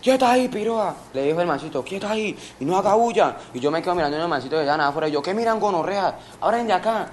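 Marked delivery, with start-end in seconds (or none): 0.0-1.0 high, raised-voice mock command; retold robber's order. Imita la orden del atracador. 1.8-3.4 higher-pitched mock command; retold robber's order. Imita la orden del atracador. 5.2-7.1 higher-pitched mock challenge; retold threat. Espanta a los mirones.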